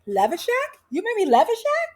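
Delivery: high, rising astonished questions; mock-astonished, questioning squeal; delighted disbelief. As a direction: imitate an amazed guest, pitch climbing. Acted astonished guest receiving a treat.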